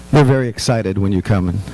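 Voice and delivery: ordinary delivery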